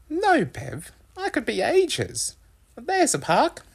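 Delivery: very high mother voice; brisk, refusing mum. A narrator's reading. Mum refuses while hunting parking.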